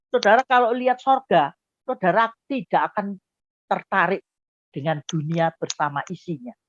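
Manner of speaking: ordinary delivery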